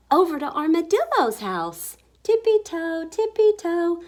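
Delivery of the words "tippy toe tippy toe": high, bouncy sing-song creeping; playful high-pitched tiptoe chant; sneaky tiptoe. A narrator's reading. Creeping animals in story.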